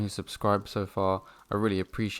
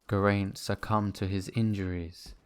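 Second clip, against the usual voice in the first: ordinary talk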